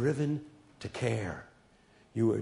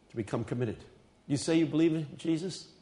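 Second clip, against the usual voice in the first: ordinary delivery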